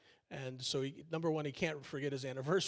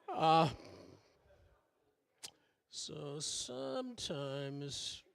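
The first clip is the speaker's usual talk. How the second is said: drawn-out hesitation; much slower than usual. Real, not acted. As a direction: drag the words out awkwardly. Awkward after joke fell flat.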